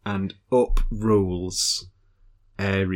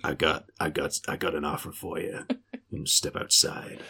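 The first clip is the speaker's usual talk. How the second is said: very low, hushed tough-guy rumble; hammed-up gruff whispery menace; Ares; 5 semitones lower, 10 dB quieter than usual. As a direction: drop into a quiet, menacing biker voice. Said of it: "he talks like this".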